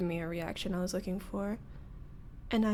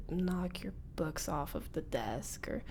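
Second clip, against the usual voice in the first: whisper; 5 semitones lower, 7 dB quieter than usual. Acted. Confessing past bullying.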